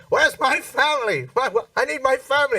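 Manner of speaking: calling out lost in the dark. Retold or imitated. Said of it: "his family are like".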